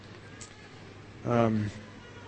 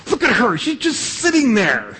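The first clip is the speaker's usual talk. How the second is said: Martha storming; 9 semitones higher than usual. Retold or imitated. Martha storms out to Jesus complaining about Mary, high indignant whine. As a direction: whine it up high like an outraged sister. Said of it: "She storms out there and says".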